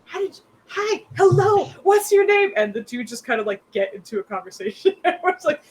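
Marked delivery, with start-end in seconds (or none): 0.0-2.5 squealing with delight, much higher; gushing over a talking dog; thrilled gushing. Acted. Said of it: "She's just".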